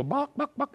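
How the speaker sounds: high squeaky buck buck buck; quick clucking chicken imitation. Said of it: "when you hear a chicken go".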